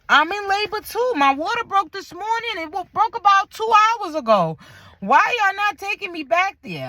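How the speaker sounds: high-pitched, worked-up complaint; acted-out outraged patient; indignant protest. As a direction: protest like you've been unfairly passed over. Acted complaint about being left waiting.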